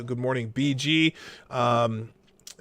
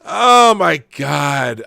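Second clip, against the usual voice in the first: loud, slow, exasperated groan of disbelief; 12 dB louder, much slower than usual. Real, not acted. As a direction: drag it out in sheer disbelief. Exasperated at the article.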